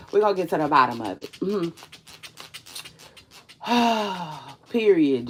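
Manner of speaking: ordinary delivery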